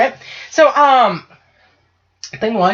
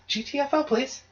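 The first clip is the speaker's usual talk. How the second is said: softer, sweetly polite rude request; mock polite; 3 semitones higher, 11 dB quieter than usual. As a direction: say G T F O softly, as if it were a kind favour. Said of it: "politely ask them".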